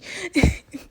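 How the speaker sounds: high burst of a giggle; a real embarrassed laugh at being caught eating. Real, not acted. Admitting to viewers she already started eating, laughing.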